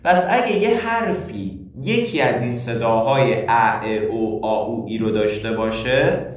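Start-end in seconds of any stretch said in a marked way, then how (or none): none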